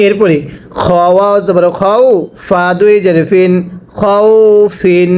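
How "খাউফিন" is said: খাউফিন stretched very slowly; teacher models খাউফিন slowly; slow drawn-out reading. Shown as a demonstration. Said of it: "আবার পড়বেন".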